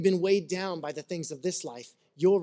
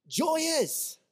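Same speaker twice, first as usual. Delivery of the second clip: much higher, bright and delighted; joyful burst; 9 semitones higher than usual. Shown as a demonstration. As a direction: sing out the word joy like it delights you. Contrasting joy with seriousness.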